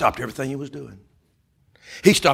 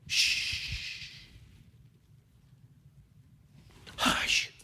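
unvoiced whispered shush; hushing; 9 dB quieter than usual. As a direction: hush the room in a whisper. Acting those who silence the cry.

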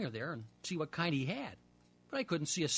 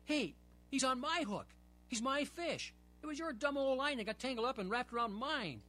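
angry protest; 8 semitones higher than usual. Acted. Boy arguing with his friend over the fish, indignant. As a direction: insist it's your fish.

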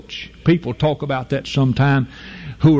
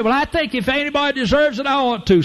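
higher and fast, smug protest; mock the entitled churchgoer; self-righteous churchgoer; 11 semitones higher, faster than usual. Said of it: "you say".

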